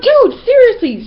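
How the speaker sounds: high, loud, drawn-out objection; disgusted protest. Retold or imitated. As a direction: stretch it out in exasperation. Acted protest at spitting noise.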